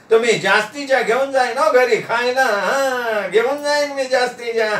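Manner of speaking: put-on high-pitched eager old friend with a chuckle; high, gleeful guest voice; delighted, greedy Nana. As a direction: gush in a high voice, giggle between phrases.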